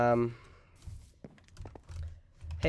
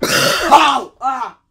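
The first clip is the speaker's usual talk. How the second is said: loud, higher yelps of hammed-up pain; 12 semitones higher, 13 dB louder than usual. Player failing a parkour jump, loud overdone yelps. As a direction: yelp out loud like it really stings. Gamer yelling at a failed jump.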